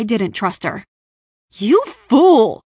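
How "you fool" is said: loud, high furious outburst; raging. A narrator's reading. Stranger berating Raya for refusing, raging shout. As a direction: explode with fury at their stubbornness. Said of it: "the stranger raged".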